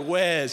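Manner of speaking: imitating Craig saying "Wes" with a buzzed z at the end; loud "Wes", ending in a z sound. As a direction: say "Wes" as if it rhymed with "fez". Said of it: "He says Wes with a Z".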